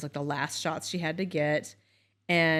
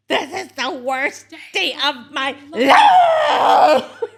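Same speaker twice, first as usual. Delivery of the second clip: higher, loud, slow hammed-up sob, laughing through it; mock child wailing; 7 semitones higher, 11 dB louder, much slower than usual. Mother imitating her sobbing daughter into the iPad, a slow loud hammed-up wail. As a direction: wail it like a heartbroken five-year-old.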